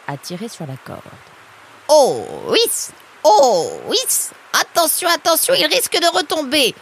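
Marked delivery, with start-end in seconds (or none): 1.9-4.0 loud, swooping hauling call; effortful heave-ho. Acted. Heave-ho while pulling the rope. 4.6-6.7 high, loud urgent warning; alarmed warning. Acted. Log may fall back.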